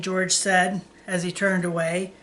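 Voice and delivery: plain reading voice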